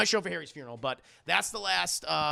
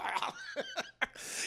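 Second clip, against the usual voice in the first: high laugh; 8 dB quieter than usual. Real, not acted. Laughs at scene.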